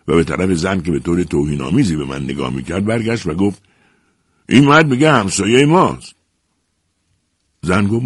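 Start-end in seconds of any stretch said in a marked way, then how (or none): 4.5-6.1 raised, higher voice of disbelief; indignant disbelief. A narrator's reading. Husband tells his wife about the new neighbour, loudly indignant. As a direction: announce it loudly, as an outrage.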